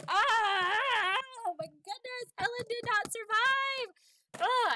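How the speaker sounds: high, loud anguished wail; real distressed cry. Real, not acted. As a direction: cry out in real shock at the loss. Sim mother dies in childbirth.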